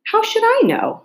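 Alice talks back to the Queen, pitched high; bold retort. A narrator's reading. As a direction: fire back in surprise at your own nerve. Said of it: "surprised at her own courage".

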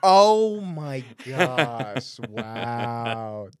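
reacting to an outrageous punchline, stunned and slow. Real, not acted. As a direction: let the shock stretch the words out. Stunned reaction to a crude joke.

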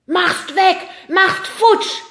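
high, very loud creature roar; bellowing yeti. Acted. Yeti roars at the television, bellowing. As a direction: bellow in panicked bursts. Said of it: "brüllte den Fernseher an".

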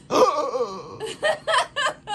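a hammed-up, high startled gasp; mock gasp. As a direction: act out his gasp with a laugh in it. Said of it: "He went like this".

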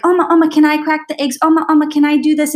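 put-on high, fast eager child voice; little girl begging grandma. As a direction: chirp it like an excited toddler tugging at grandma. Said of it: "some of my first memories are just like".

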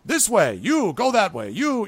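loud, high-pitched commands; issuing orders. Acted. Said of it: "issuing orders".

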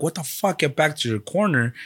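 exasperated retelling, pitch jumping up; retold angry outburst. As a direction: retell an indignant order to back off.

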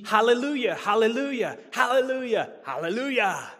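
high, loud repeated hallelujah; fervent hallelujahs. Acted. Preacher calling out hallelujah four times, high and loud. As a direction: ring out each hallelujah.

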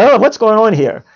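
high, loud mock surprise; startled attention. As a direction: imitate a dozing listener suddenly alert. Voices listeners suddenly woken up.

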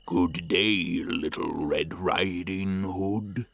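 lightly voiced sly wolf; slow, sly wolf greeting. A narrator's reading.

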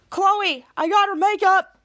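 high, loud call into the house. Retold or imitated. Jasper to Chloe inside the house, calling out. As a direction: holler it to someone indoors.

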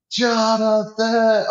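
high, slow, distant call of a name; faint far-off call; called from afar. Retold or imitated.

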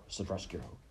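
usual speaking voice

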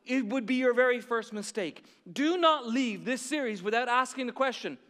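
loud, high impassioned preaching; preaching at full force. Acted. Pastor urging the church, loud full-force preaching.

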